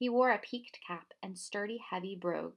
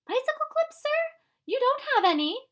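much higher, louder woman's voice; housekeeper's voice; 10 dB louder than usual. A narrator's reading. Missus Primrose answers the professor, in a high woman's voice. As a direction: play the fussy housekeeper.

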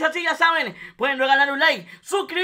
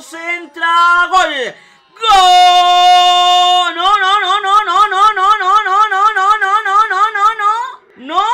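excited goal shout; 3 semitones higher, 10 dB louder, slower than usual. Real, not acted. Goal call then disbelief.

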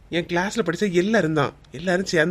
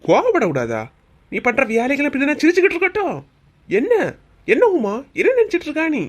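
scolding husband; 6 semitones higher than usual. Acted. Husband scolding wife in front.

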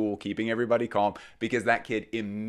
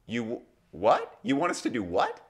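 incredulous disbelief; 4 semitones higher than usual. Retold or imitated. Staff baffled by demand.

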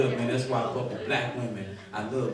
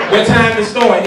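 loud, higher, laughing question; 6 semitones higher, 13 dB louder than usual. Retold or imitated. Retold church invitation exchange.

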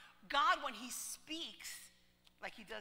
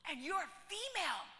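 astonished question; 6 semitones higher than usual. Retold or imitated. Girl amazed at female pastor.

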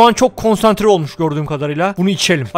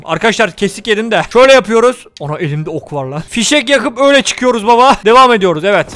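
normal talking voice

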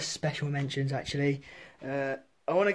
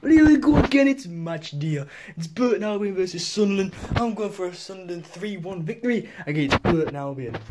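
mock Mackem match-day preview; lightly done Wearside accent; Sunderland accent; 4 dB louder than usual. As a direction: predict the score like a Sunderland fan. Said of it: "And finally, Sunderland".

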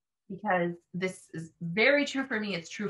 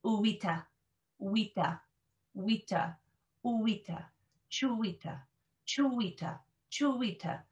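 building back from wita to chuwita; backward build-up drill; 3 dB quieter than usual. Shown as a demonstration. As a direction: drill the end of the word first. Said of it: "extra practice on that final part".

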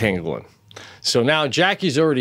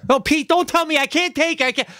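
high mock wife; faster than usual. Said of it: "wife's retort voiced".

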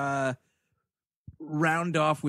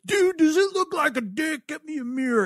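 high, pitched-up dude asking for a mirror; mock dude voice; 10 semitones higher than usual. Podcast host acting out a character asking for a mirror, high mock voice. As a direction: play the clueless guy in a squeaky high voice.

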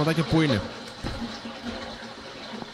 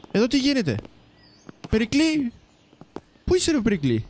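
calling out; 8 semitones higher than usual. Real, not acted. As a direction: call out for Pericles across the shop. Calling out to game cook. (δυνατό φώναγμα)

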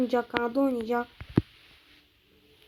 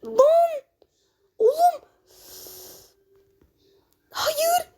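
high, sharp cries of dismay; anguished yelps; 13 semitones higher, much slower than usual. Real, not acted. Gamer crying out as the chance slips away, high yelps. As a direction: yelp out each word in disbelief.

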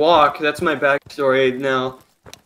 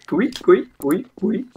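imitated boing; 7 semitones higher than usual. Imitates game sound effect.

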